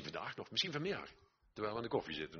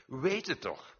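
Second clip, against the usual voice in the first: high, loud urging; emphatic preacher's plea; loud and clear; 7 semitones higher, 6 dB louder, slower than usual. Real, not acted. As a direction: press it on the congregation, voice raised. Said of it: "ik zeg het nou hard en duidelijk".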